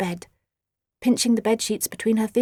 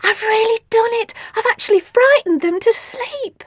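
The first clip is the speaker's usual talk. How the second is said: excited; 12 semitones higher, 5 dB louder than usual. Acted. Triumphant excitement.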